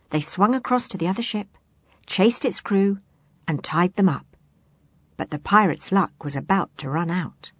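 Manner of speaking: ordinary delivery, nothing marked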